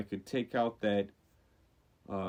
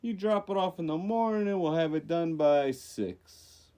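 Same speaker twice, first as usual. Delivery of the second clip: put-on Jersey accent; 6 semitones higher than usual. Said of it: "made it sound like a Jersey guy".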